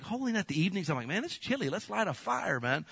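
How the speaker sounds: a relaxed happy sigh of a line; delighted beach voice. Retold or imitated. Recalling a beach night by the fire, pleased. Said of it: "I was like".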